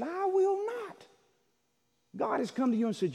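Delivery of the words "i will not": high, drawn-out mock defiance; defiant refusal. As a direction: refuse God outright, stretching each word high. Acts out flat refusal to God.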